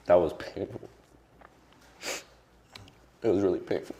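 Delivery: tearful. Real, not acted. Guest crying to the interviewer about his mother's disappointment. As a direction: push through while crying. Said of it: "I've been crying a lot this week".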